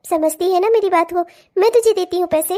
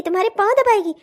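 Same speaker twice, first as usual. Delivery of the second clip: usual speaking voice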